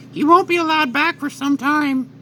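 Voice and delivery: King Kai. Acted. King Kai voiced again.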